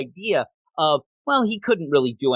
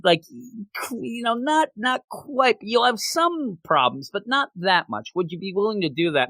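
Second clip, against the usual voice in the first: lightly voiced spirit; 6 semitones higher than usual. Spirit offering deal, voiced.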